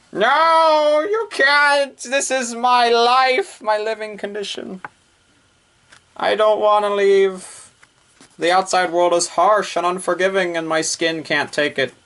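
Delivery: put-on high, whiny shut-in girl voice. Hatsuyuki refusing to leave the house, whiny high protest. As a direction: whine like a stubborn shut-in.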